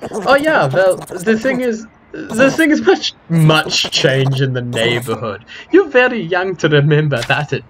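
put-on loud, high, slow accented backpedalling; flustered foreign-accented character. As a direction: bluster for an excuse in a thick accent. Voices a game character.

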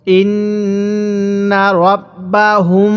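"ইন্না রব্বাহুম" recited slowly with long nasal hums on nn and final m; ghunnah. Shown as a demonstration. Teacher showing how to read it, "ইন্না রব্বাহুম" with sustained ghunnah. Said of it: "এজন্য এটা গুনাহ করব".

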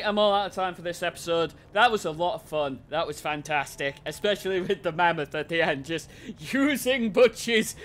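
ordinary delivery

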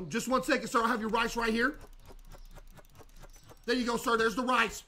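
over-friendly store clerk; cheery shopkeeper voice. Acted. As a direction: greet the customer like an eager cashier. Serves customer as shopkeeper.

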